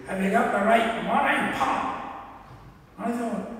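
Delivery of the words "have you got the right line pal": louder, higher Scots driver's gruff question; a hint of a Scottish bus driver. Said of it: "the driver said to me".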